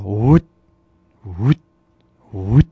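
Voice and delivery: low, short voiced woots; duck call sounds. Shown as a demonstration. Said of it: "That's the basic duck call".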